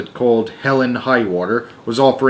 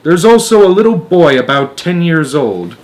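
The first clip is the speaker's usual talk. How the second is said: excited; 6 semitones higher, 6 dB louder than usual. Acted. Astronaut keeps reporting.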